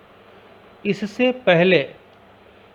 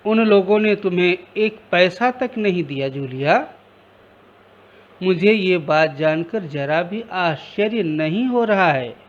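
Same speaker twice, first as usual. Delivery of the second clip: usual speaking voice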